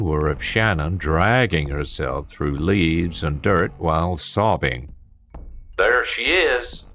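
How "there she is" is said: slow, warped distorted call; distorted shout. Acted. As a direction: shout through a distorted speaker. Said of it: "the distorted voice shouted".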